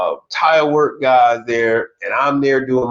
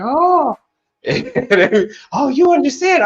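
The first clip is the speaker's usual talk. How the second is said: high surprised "oh"; mock shock that he understands; surprised women; 12 semitones higher than usual. Women realising he understood them, high surprised. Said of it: "they'll be like".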